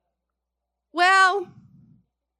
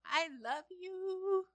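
slow, much softer, tender; a slow, hushed, loving reassurance; tender God; 10 dB quieter, much slower than usual. Acted. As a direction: speak it gently as a loving father. Voicing God's loving words.

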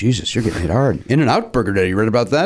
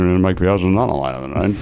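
low, mumbled sportscaster impression; Ross Porter; 5 semitones lower than usual. Drunk old play-by-play man calling a player, slurred impression. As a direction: mumble it low, trailing into the tag question. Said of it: "People are finding a Ross Porter impression".

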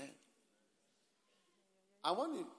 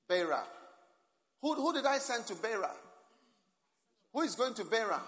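loud questioning call; 8 semitones higher, 6 dB louder than usual. Real, not acted. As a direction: call out to the room. Calling out to the congregation.

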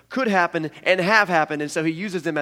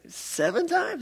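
hopeful question; 13 semitones higher, much slower than usual. Retold or imitated. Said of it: "I say".

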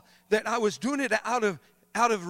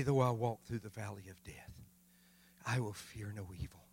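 much lower and softer, intimate; hushed reflection; 9 semitones lower, 5 dB quieter than usual. Acted. Preacher softly reciting the psalm to the congregation. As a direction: draw them in with a hushed psalm.

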